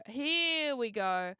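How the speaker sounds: HERE stretched long and slow; drawn-out. Real, not acted. Streamer about to show the video, drawn-out HERE. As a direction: draw out "Here" before "we go". Same words, now stretched out.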